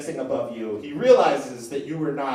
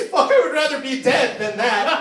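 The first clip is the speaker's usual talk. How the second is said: high, louder anguished yell; rat god screaming; 8 semitones higher, 6 dB louder than usual. Acted. Rat god howling as his fingernail is plucked. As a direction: howl it in pain. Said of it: "the rat god just screams".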